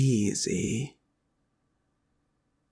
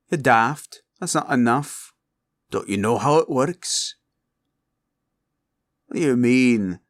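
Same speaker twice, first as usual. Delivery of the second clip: louder, higher indignant scolding; indignant retort; 3 semitones higher, 5 dB louder than usual. Acted. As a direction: scold the greedy hireling. Dragon argues about platinum.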